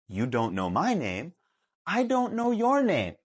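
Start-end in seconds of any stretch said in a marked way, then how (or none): 1.8-3.2 exasperated high protest. Pretend.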